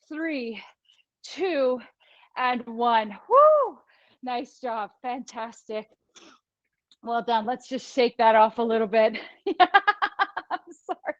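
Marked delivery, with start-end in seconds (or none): 3.3-3.8 high, loud whoop of encouragement; cheering whoop. Real, not acted. Cheering class after the set. 9.5-11.1 slow apology broken by laughter; laughing apology. Real, not acted. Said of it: "I'm laughing 'cause".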